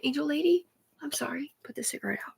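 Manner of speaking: soft reply fading to a whisper; meek apology. Retold or imitated. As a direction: apologise softly, trailing into near silence. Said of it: "I'm all".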